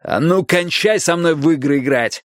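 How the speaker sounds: gruff shout; yelling. A narrator's reading. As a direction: bark at her to stop. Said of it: "заорал Страйк".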